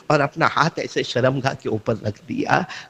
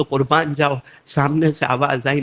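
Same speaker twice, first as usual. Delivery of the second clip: ordinary delivery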